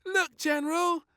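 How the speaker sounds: high, loud excited call; high loud little girl; excited cry. A narrator's reading. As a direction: show off the jump. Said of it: "cried little Princess".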